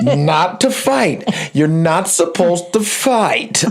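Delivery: deliberate, drawn-out parental telling-off; responsible daddy. Retold or imitated. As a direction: lay it down like a dad acting strict. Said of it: "I got to be the responsible daddy".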